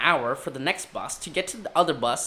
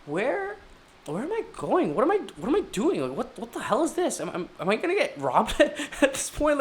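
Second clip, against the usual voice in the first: nervous confusion; 8 semitones higher than usual. Retold or imitated. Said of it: "and I'm like".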